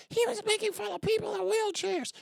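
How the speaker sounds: very high squeaky mock voice; shrill falsetto parody of a critic; mocking whiner. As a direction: squeal it like a whiny accuser. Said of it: "be like".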